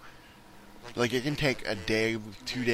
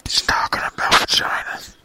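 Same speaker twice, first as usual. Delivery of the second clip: loud, voiceless stage whisper; exaggerated whispered aside; mock whisper; 9 dB louder than usual. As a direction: whisper it forcefully so everyone hears. Joking aside mocking the innuendo.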